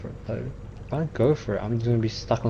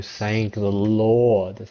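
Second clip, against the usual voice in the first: ordinary talk, nothing marked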